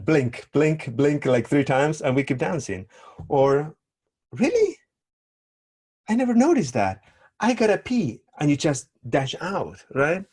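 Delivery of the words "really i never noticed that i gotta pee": high, louder mock astonishment; funny me. Dancer fleeing feedback, hammed-up high surprise. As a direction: play it up as a comic escape. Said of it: "the funny me".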